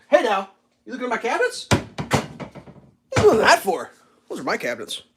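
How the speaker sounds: high, playful scolding; scolding the dog. Real, not acted. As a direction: tell off a pet playfully.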